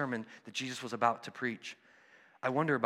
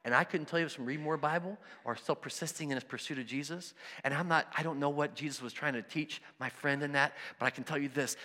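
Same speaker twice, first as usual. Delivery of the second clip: usual speaking voice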